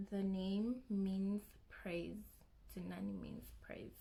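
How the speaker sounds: ordinary delivery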